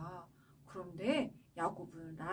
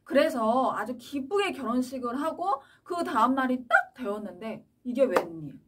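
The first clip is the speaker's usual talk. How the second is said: ordinary talk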